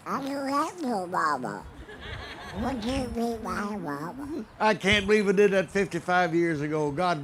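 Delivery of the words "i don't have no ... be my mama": sad, soft little cartoon duck; high, soft, a wistful duck; Yakky Doodle duck voice. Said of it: "He always answered sadly".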